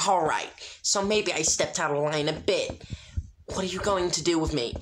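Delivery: put-on a proud girl faking toughness; Sunset's defiant voice. A narrator's reading. Sunset argues with spirits.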